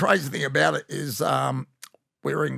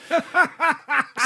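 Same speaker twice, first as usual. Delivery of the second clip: a sudden, much higher cackle; high burst of laughter; 13 semitones higher, 3 dB louder than usual. Real, not acted. Telling his mate about the stingy boss, bursting into high laughter. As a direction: crack up at your own joke.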